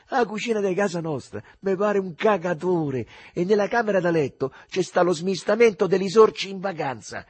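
mock the poor house with cheeky irony; ironic, loud and high. Lenzetta jokes about his home to the old man, ironic and loud. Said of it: "pisciando ironia dagli occhi".

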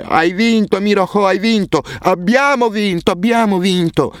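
grida di gioia molto acute, allungate; joyful shouting. A narrator's reading. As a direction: esulta saltellando per la vittoria. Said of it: "ridendo tra le lacrime". (i due ragazzi festeggiano: grida di gioia acute)

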